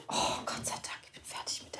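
relieved whisper. Real, not acted. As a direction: breathe it out in a whisper. Relieved whisper after answering. (erleichtert flüsternd)

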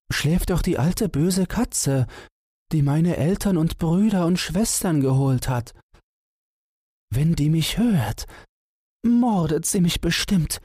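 high mouse voice with a touch of fear; a tiny mouse dreading the cat; high squeaky mouse voice, uneasy; frightened little mouse. A narrator's reading. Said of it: "rief das Mäuseken traurig".

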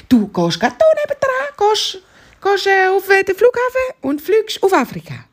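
high-pitched zebra giving directions; excited zebra. Acted. Zebra directs hare to the airport, high and eager.

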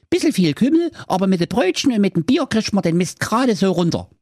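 hohe, eilige Ritterstimme mit viel Gehabe; hoch und flott, wie ein aufgeregter Prinz; Ritter Prinz Meisenherz. Acted. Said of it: "fuhr Ritter Prinz Meisenherz fort". (hohe, aufgekratzte Ritterstimme beim Bericht an den Kommissar)

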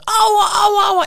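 high loud fake wail of pain; mock child's wail. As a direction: howl it like a hammed-up hurt kid. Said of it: "Aber auch richtig".

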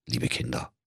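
put-on whispered aside to children. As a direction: whisper it confidingly. (geflüstert)

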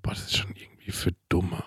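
hushed whisper of a mean opinion. Retold or imitated. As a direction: whisper it like a guilty confession. Retold thought, embarrassed opinion.

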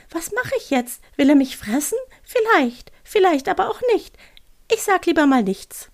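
high, dithering owl voice; agitated little owl. Acted. Owl wondering whether it will be eaten, high flustered voice. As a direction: waver anxiously, pitched well up. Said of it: "rief die Stimme aufgeregt".

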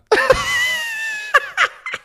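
shrieking high laugh; real laughter bursting out. Real, not acted. Laughs at own story.

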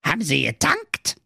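high, odd little dwarf voice; grumpy dwarf. Acted. The dwarf asks Marco about fuel, high and odd. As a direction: ask it like a sour gnome.

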